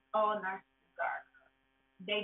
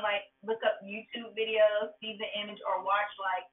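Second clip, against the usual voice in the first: ordinary delivery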